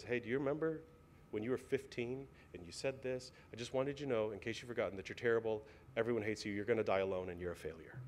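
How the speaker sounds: quiet, sinking, lightly voiced accuser; accusing inner voice. Pastor voicing the inner voice that accuses, quietly. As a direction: let the voice of accusation creep in softly. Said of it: "and this voice says".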